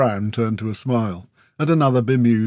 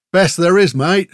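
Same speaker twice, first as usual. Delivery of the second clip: loud, raised snarl; 7 semitones higher, 7 dB louder than usual. A narrator's reading. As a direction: snarl it back in defence of your gear. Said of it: "he snarled".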